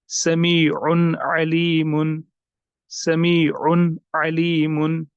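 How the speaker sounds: "समीउन अलीमुन" slow, न of समीउन sounded fully; careful recitation; clear tanween. Shown as a demonstration. Teacher recites with clear noon. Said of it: "ये भी जाहिर होना चाहिए".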